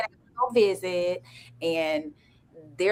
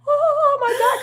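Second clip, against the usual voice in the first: much higher, teary gasp of surprise; shocked delight; 6 dB louder, slower than usual. Shown as a demonstration. Showing how a surprised fiancée should react, near tears. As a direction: act overwhelmed by a surprise proposal. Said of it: "you're supposed to be like".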